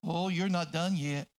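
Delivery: low, unruffled reply; calm correction. Acted. Calm answer to the quitter.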